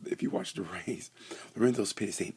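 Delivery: normal talking voice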